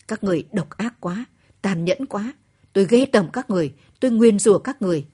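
loud, fast, cursing them with hatred; furious outburst. Acted. Wife cursing the cruel soldiers, furious and loud.

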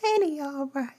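high, hammed-up praise; mock ecstatic worshipper. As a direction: gush like an over-excited churchgoer. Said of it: "telling people".

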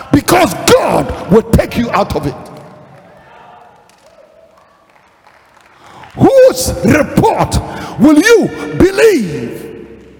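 high, shouted, drawn-out proclamation; full-force preaching. Real, not acted. Preacher asking whose report they will believe, full-force preaching. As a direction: shout the challenge slowly to the whole congregation.

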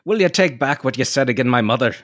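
high-pitched stage-Irish lilt; caricature Irish accent. Acted. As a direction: play up an old-fashioned Irish-American brogue. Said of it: "nineteenth century caricatures of Irish Americans".

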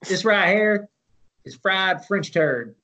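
slow Southern TV chef drawl, a touch hammed; Southern cooking-show host presenting his dish in accent; Southern country cook accent. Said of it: "in a Southern accent".

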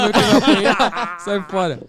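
laughing while protesting. Real, not acted. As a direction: say it through laughter. Joking about getting beaten up.

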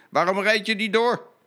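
high, loud hail to a stuck truck; conductor calling out. Acted. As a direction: call out to stop him. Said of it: "riep de conducteur".

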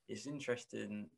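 ordinary talk

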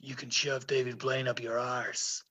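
soft, low West Country burr; a hint of Somerset accent. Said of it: "he's from Somerset".

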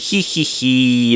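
slow, drawn-out "hee hee hee"; mock giggle. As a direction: imitate an impish cartoon snicker. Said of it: "He's like".

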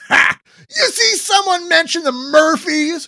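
high, loud gleeful whoop. Acted. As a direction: crow it out to the whole gang. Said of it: "Sharky whoops".

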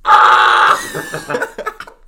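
sudden blaring mock scream; imitated scream. Imitating the sound effects guy, blasting scream. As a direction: explode into a very loud "ah". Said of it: "imitates sound effects".

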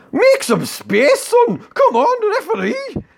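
high-pitched, drawn-out angry yell; angry football shout. Acted. Martin berates a man hogging his armrest, angry high shout. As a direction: bellow it like an irate fan at a match. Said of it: "Martin's getting angry".

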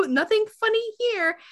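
very high sing-song denial; high put-on maid voice; mock Georgina. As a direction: insist too sweetly, up high. Georgina acted reassurance.

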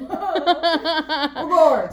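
high, loud laughing outburst; laughed out loud. Real, not acted. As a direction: crack up while saying it. Laughing at own joke.

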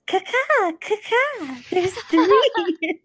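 high, floating bird-call voice; ethereal voice. Acted. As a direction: call out eerily from hiding. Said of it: "her ethereal voice coming out of nowhere".

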